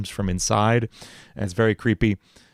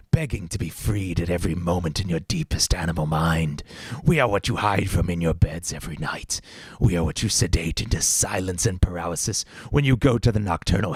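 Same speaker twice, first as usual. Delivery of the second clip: villain monologue; 3 semitones lower than usual. Acted. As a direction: reveal yourself as the madness inside them. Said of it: "crazy villain monologue".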